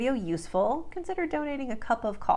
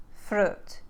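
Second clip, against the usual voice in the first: Russian "fruit" with the short vowel of "should"; Russian accent. Shown as a demonstration. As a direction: shorten "fruit" to rhyme with "foot", Russian style. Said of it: "will become uh, like should".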